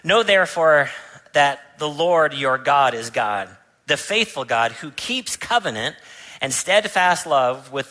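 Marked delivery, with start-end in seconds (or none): none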